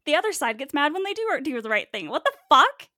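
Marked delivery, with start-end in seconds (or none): 2.1-2.9 loud, high disbelieving yell; outraged shout. Real, not acted. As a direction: burst out in disbelief. Outraged outburst.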